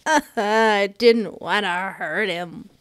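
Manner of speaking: slow, tearful words through a laugh; crying a little. Real, not acted. Player crying over hurting him, slow and tearful. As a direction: choke it out slowly, teary. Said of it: "I'm actually crying a little bit".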